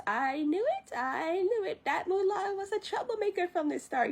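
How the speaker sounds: high-pitched complaining ancestor lady. Retold or imitated. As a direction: imitate a lady saying I-told-you-so. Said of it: "And the lady says".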